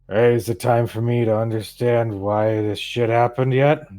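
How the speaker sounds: put-on loud, belligerent drunk; drunken man. A drinker bursts into the temple demanding answers, loud drunken belligerence. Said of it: "He's got a bottle of alcohol".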